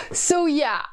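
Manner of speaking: ordinary talk, nothing marked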